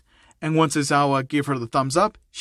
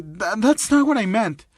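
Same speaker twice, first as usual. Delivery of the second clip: higher, flustered stammer; embarrassed; 6 semitones higher, 3 dB louder than usual. Acted. Girl who blurted out her thought, backtracking in embarrassment. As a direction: stumble into the denial, mortified. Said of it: "even redder from embarrassment".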